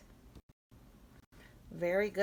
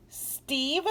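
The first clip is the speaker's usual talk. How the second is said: Steve said higher with a rise; voiced, rising; 6 semitones higher than usual. Shown as a demonstration. Teacher saying Steve after whispering it. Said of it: "the long E says E".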